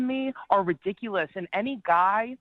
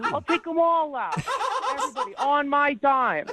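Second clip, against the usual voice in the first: higher-pitched, exaggerated sarcastic offer; sarcastically over-willing; 6 semitones higher than usual. Real, not acted. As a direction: pile on the mock generosity, pitch lifted. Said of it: "I'm being sarcastic".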